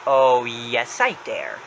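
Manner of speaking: low, drawn-out defiant reply; Danny's voice. Retold or imitated. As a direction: answer the dare with the voice dropped. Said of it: "And Danny says".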